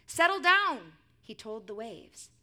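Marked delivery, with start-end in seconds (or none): 0.1-1.0 firm, booming storybook order; forceful, slow imperative; loud command. A narrator's reading. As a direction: command the waves, loud and drawn out. Jesus commanding the waves in story.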